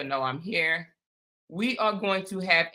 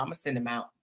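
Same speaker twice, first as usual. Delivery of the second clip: much softer, lower aside; quiet promise; 9 semitones lower, 9 dB quieter, much faster than usual. Real, not acted. Host quietly promising viewers she'll send alerts. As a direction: mutter the promise to yourself.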